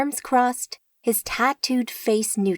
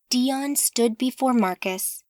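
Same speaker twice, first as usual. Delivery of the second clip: plain reading voice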